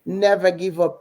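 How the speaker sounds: usual speaking voice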